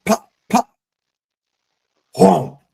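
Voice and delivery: high popping gun noises, then a heavy whomp; imitated gunshots. Telling his wife's story, he mimics the gunshots he heard. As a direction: mimic two small shots and a dull blast. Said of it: "I hear".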